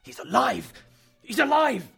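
loud, high-pitched joyful shout; exultant shout, leaping up in pitch; cry of joy. A narrator's reading. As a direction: cry out in overjoyed relief. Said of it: "he uttered a cry of joy".

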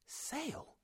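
very high, hushed echo; soft, high-pitched astonishment; shocked. A narrator's reading. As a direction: gasp the word in disbelief. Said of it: "The word shocked me".

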